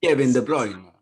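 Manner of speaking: loud, hammed-up Italian accent; punchy mock-Italian pronunciation. Shown as a demonstration. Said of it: "a bit of an Italian accent".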